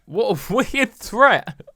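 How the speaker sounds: high, slow, laughing through weird. Real, not acted. Laughs while repeating.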